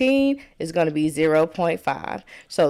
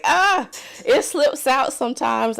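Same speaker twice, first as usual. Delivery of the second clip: Southern twang; 7 semitones higher than usual. Retold or imitated. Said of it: "little country twang moment".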